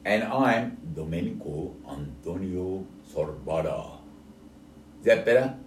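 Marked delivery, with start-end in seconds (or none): 0.0-3.9 full name said slowly and lower; slower. Shown as a demonstration. Asked by the co-host to say his name slower, slow. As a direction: stretch each part of the name out slowly. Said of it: "No, a bit slower".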